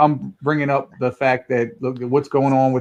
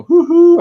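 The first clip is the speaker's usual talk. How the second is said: hoo-hoo whoop; 10 dB louder than usual. Real, not acted. Hype cry while bragging.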